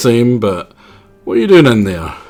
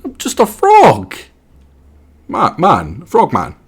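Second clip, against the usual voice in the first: frog voice; 5 semitones higher, slower than usual. Acted. Voicing the frog character.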